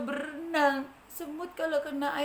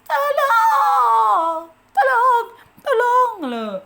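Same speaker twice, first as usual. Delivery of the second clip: high, loud tearful cries of tolong; panicked crying for help; 8 semitones higher, 14 dB louder, much slower than usual. Acted. Sinking ant cries out to anyone nearby. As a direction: scream for help as you sink. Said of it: "kata semutnya".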